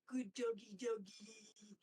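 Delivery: lightly voiced cooing otter, childlike; a hint of a creepy, doting otter; odd otter voice. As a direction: coo at the captive dogs like a strange pet owner.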